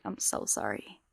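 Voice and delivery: breathy whispered sorry; hushed mock sympathy; pitying whisper. Recruiters' pitying look.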